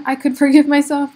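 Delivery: sheepish laugh through the words. Real, not acted. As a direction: laugh at the irony. Laughing at her own paradox.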